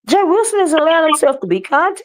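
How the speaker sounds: high, incredulous "What?" at the news; shocked disbelief. Retold or imitated. To the co-host, retelling her reaction, high shocked disbelief. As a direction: act like you can't believe what you just heard. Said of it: "I was like".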